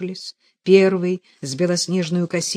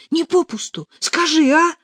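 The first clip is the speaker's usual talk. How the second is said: high, louder, pleading demand; impatient insistence; 12 semitones higher, 7 dB louder than usual. A narrator's reading. Katya demanding to hear it wasn't in vain, impatient. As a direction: press for an answer, high and urgent. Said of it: "не вытерпела Катя".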